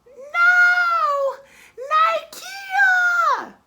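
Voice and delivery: very high, loud shriek of a name. Retold or imitated. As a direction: scream it out in panic. Girl shrieks her name.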